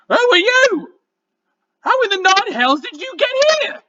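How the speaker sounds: high, croaking goblin. Acted. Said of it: "in a croaking voice".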